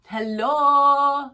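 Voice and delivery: loud incredulous "Hello?". Acted. Reader shouts at the client in disbelief, loud. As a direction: call out in mock disbelief.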